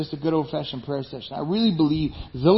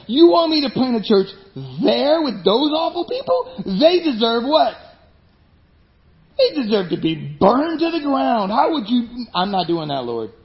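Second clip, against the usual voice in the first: indignant Jonah; 5 semitones higher than usual. Retold or imitated. Jonah protesting to God.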